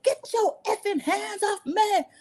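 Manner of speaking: hammed-up retold fight line; mock angry yell. Simone shoving someone off in the preview, mock angry yell. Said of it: "Simone said".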